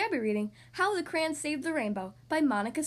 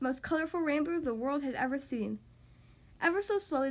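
usual speaking voice

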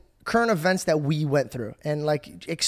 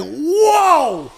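high, loud, drawn-out whoa; amazed shout; 11 semitones higher, 7 dB louder than usual. Retold or imitated. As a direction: holler it in sheer astonishment. Retold amazed reaction.

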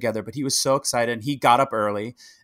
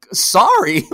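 loud, higher mock disbelief; incredulous retort; 5 semitones higher, 9 dB louder than usual. Answering people who said sorry for not winning, incredulous. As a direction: throw it back as an astonished question. Acts out indignant reply to condolences.

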